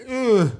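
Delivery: high, loud grunt imitating a yawn; imitating a pouty yawn groan; mock yawn grunt.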